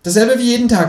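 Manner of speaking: high, cheeky kangaroo voice. Acted. Reading aloud, the narrator voices the Kangaroo asking him to pay, high and cheeky.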